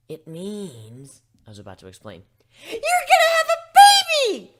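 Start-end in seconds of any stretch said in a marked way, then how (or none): 2.7-4.5 very high, very loud, slow squeal of joy; a bubbly pony shrieking the baby news; ecstatic shriek. Acted. Pinkie Pie blurts the news.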